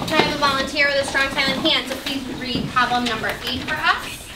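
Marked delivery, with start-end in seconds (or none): none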